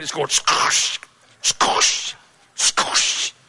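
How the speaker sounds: whispered squish noise; squishing sound. Retold or imitated. Preacher describing honey between Samson's toes, whispered squish.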